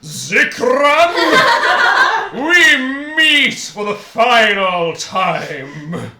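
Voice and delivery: loud, slow, laughing villain declaration. Acted. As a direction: boom it out like a gloating villain. GM voices villain's battle cry.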